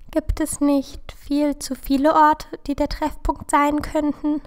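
high, squeaky worried questioning; Peter, squeaky. A narrator's reading. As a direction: pitch it up into a timid squeak. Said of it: "piepste Peter rau". (hohe, piepsige Stimme)

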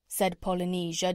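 ordinary delivery, nothing marked

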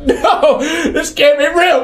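high, slow, desperate disbelief; panicked denial. Retold or imitated. Wrestling the demon Kim, a high panicked plea.

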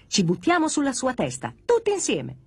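eager plotting. Acted. Blocks plan to jump on the doll, eager. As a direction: egg the gang on.

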